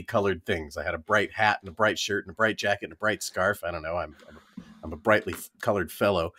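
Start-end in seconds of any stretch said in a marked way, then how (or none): none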